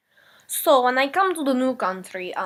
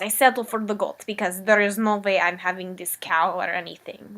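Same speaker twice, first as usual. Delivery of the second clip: ordinary talk, nothing marked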